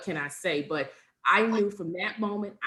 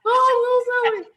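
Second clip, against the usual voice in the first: much higher, loud cry; wailing apology; 8 dB louder than usual. Real, not acted. Apologizing to listeners mid-story, high loud wail.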